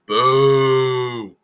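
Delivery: mock boo. Mocking a rival fan's boo.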